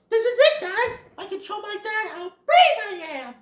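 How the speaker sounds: high, loud, eager youngster; Bambi. Acted. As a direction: proclaim it proudly in a high child voice. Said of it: "Bambi's all like".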